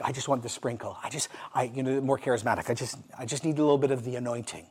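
soft, hesitant imitation of a seeker; hushed mock yearning. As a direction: plead softly, haltingly, as if for a small favor. Voicing charismatic sprinkle seekers.

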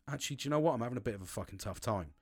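soft, low confiding admission; quiet admission. Retold or imitated. Man confiding in his partner, soft and low. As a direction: let it drop low and soft.

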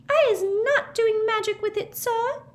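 very high, squeaky tearful squeal; the elf squeals her innocence in tears; squealing house-elf. A narrator's reading. Winky pleading with Mister Diggory, high tearful squeal. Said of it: "squealed Winky".

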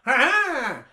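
loud, high mock villain laugh; villain's "ha ha". Imitating an ambusher behind the tree, triumphant laugh. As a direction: burst out with a hammed-up gotcha laugh. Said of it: "And he's like".

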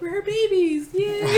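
high, loud cheering with a laugh; a relieved kid celebrating; gleeful high cheer; kid's glee. Retold or imitated. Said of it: "I'm like".

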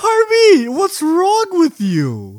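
high, loud, drawn-out wail of disbelief; exasperated shout. Real, not acted. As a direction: cry out in comic exasperation, stretching it. Mock outrage at the quoted question.

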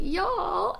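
high, whiny mock-sad echo. Echoing her co-host's sad Fearless [name], high and whiny. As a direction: wail it back like a hurt teen. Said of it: "Fearless [name]'s all sad and says".

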